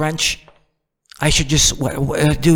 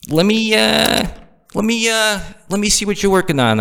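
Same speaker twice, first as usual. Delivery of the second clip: pestering know-nothing; 8 semitones higher than usual. Acted. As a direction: stall on a high, stretched "let me, uh". Mock pestering Albert Einstein.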